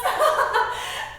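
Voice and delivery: gleeful laugh. Acted. [name] laughs.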